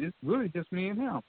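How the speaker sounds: ordinary delivery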